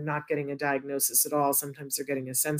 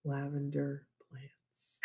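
sleep story narrator; slower than usual. Retold or imitated. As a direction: drift them off to sleep. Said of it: "the person who's like".

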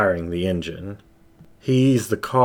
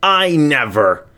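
much higher and louder, slow angry protest; angry denial; 8 semitones higher, 6 dB louder, slower than usual. Acted. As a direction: bite off the denial. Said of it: "the squirrel growled to the void".

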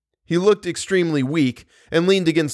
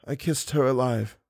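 faint, dying murmur; 6 dB quieter than usual. Acted. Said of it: "making an effort to speak more connectedly".

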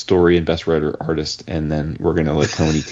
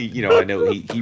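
ordinary delivery

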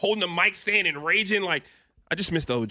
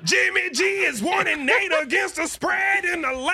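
higher, shouted broadcast trend read; dramatic reality show hype; 8 semitones higher than usual. Pretend. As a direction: read it like a hyped TV graphic. Mock broadcast trend graphic.

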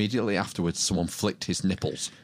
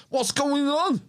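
high-pitched mock alarm; alarmed teacher; 12 semitones higher than usual. German teacher to bleeding pupil, alarmed teacher voice. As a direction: act a teacher stopping the lesson.

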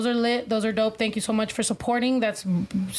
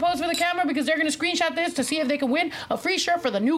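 hurried; 4 semitones higher, faster than usual. Real, not acted. Rushing everyone into group photo.

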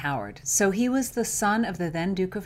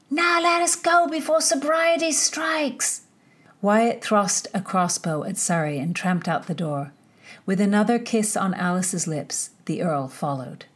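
measured audiobook reading voice; story narration; 5 dB louder than usual. A narrator's reading. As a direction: read the chapter like a storyteller. Reads the novel chapter aloud.

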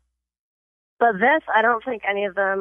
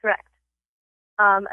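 usual speaking voice

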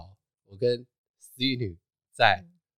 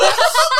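sudden high loud laugh; burst of laughter; 11 dB louder than usual. Real, not acted. Storyteller bursting out laughing recalling the scene. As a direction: break into laughter.

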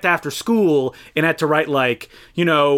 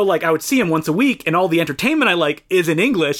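ordinary talk, nothing marked

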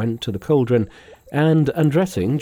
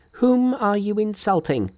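very high outraged monkey voice; screamed indignant question; 10 semitones higher than usual. A narrator's reading. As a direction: demand it in a high, indignant cry. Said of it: "he screamed".

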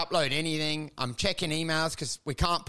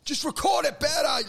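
retold yell; 8 semitones higher than usual. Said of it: "just yelling like".

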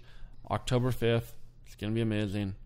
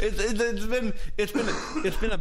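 laughing; 7 semitones higher than usual. Real, not acted. Laughing through rant.

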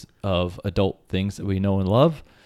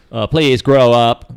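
talking calculator voice; 8 dB louder, slower than usual. Acted. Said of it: "It's a talking calculator".

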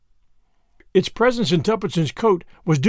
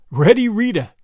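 thrilled, louder repeat of the nickname; higher, punched out in mild rapture; delirious; 4 semitones higher, 5 dB louder than usual. A narrator's reading. Albert relishing the crook's nickname, deliriously excited. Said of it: "repeated Albert deliriously".

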